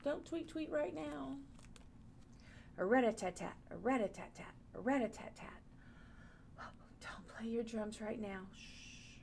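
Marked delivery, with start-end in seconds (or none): 2.8-5.6 drum sounds. Pretend. Teacher imitates drums. 6.6-9.2 soft whispered hush after a gasp. Pretend. Gasp then hushing the drums.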